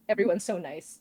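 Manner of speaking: ordinary delivery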